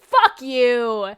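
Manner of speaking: loud, blunt retort to a fan; mock hostile yell. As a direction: blurt it out loud in exaggerated hostility. Acting out cruel reply to fan.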